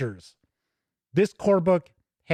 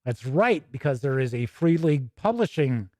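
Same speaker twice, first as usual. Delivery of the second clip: normal talking voice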